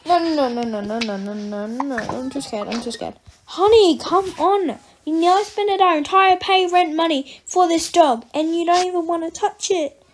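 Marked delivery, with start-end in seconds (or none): 3.4-10.0 louder, higher scolding; a parent scolding the daughter over the money; exasperated scolding. Acted. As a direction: tell her off, fed up.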